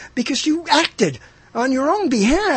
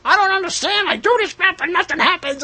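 frustrated buyer; 8 semitones higher than usual. Retold or imitated. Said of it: "people going like".

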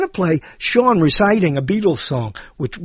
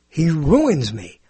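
ordinary talk

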